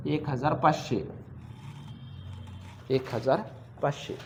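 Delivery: low, soft figures said while writing; murmured dictation. Real, not acted. Teacher writing the amount down, murmuring it. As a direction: say it low, half to yourself.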